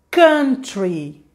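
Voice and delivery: country repeated as kuntri; kuntri, clear and firm; correct pronunciation. Shown as a demonstration. Teacher repeats correct country for viewers. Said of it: "A palavra é".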